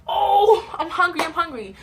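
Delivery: put-on high, loud eager little-kid voice; excited kid. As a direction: burst out like a starving fifth grader.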